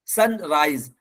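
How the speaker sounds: "सनराइज" said "sun-raiz". Shown as a demonstration. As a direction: end "सनराइज" on a voiced Z. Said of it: "एस की आवाज जा से आएगी". ("सनराइज" में ज़ा की आवाज़)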